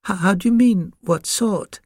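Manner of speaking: high, hesitant little puppy; small puppy voice. A narrator's reading. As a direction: stammer it in a tiny high voice. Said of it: "in an extremely small voice".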